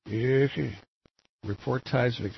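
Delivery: ordinary talk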